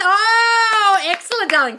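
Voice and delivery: thrilled. Real, not acted. Host celebrating a viewer's flooded phone, thrilled. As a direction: cheer with overflowing joy.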